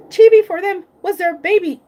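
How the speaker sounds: tearful, choked words; crying. Real, not acted. Grieving pet owner tells viewers, crying. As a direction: voice breaking as she talks about the pet.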